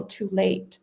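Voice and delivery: normal talking voice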